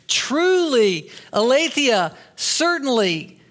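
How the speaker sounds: high, punched single words; emphatic insistence. Acted. Bystanders press Peter on who he is, high emphatic insistence.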